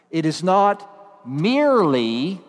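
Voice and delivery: "merely" drawn out slowly with a rising tune; slow down and lean on "merely"; stress on merely. Shown as a demonstration. Preacher telling the congregation to listen, stressing "merely". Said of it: "I'll emphasize the key word".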